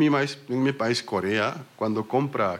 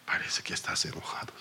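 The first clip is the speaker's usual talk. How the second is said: whispered; 7 semitones lower, 9 dB quieter, faster than usual. Retold or imitated. Daughter quoted telling father.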